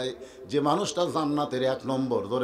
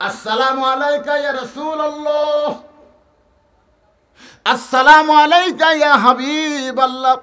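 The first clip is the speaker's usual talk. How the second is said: impassioned preaching; 8 semitones higher, 8 dB louder than usual. Acted. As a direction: call it out melodically to the congregation. Preacher calls out greeting.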